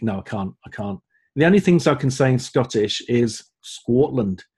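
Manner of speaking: ordinary delivery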